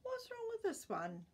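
put-on high, bright old man voice for Grandpa; puzzled Grandpa, pitched high; lightly voiced puzzled grandpa. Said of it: "said Grandpa".

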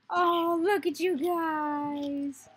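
high, loud, long drawn-out gush; slow swooning coo over cute pets; adoring coo. Real, not acted. Gamer greeting the playing slimes, adoring coo.

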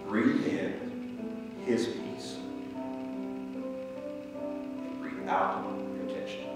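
slow, soft meditation voice; guided meditation. Acted. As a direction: guide them into stillness, slowly.

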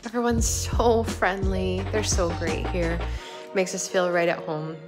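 ordinary delivery

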